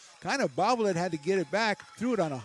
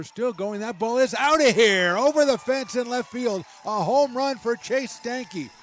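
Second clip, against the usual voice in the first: rising excited call, louder; home run call; 4 semitones higher, 5 dB louder than usual. Real, not acted. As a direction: call it as it clears the fence.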